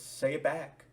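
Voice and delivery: loud, insistent demand. Retold or imitated. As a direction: bark the order down the phone. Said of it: "and she goes".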